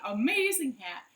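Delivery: usual speaking voice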